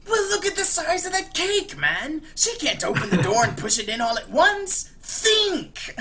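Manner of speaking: high, loud manic Joker; hammed-up high Joker rant. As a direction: explain it like a giddy madman. Joker explains cake plan.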